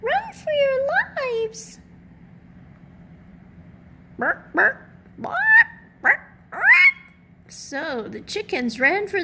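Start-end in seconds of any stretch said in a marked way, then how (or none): 0.0-1.8 much higher, slow squeaky chick warning; squeaky chick voice shouting run; panicky peep. A narrator's reading. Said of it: "she peeped". 4.2-6.6 higher, louder squawking bawks; frantic chicken bawk bawk; chicken squawks. Acted.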